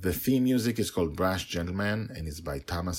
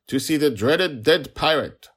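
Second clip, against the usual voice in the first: higher, louder insistent summons; guard, higher voice; 10 semitones higher, 10 dB louder than usual. Acted. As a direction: repeat it, pitch climbing with irritation. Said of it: "he repeated in a higher voice".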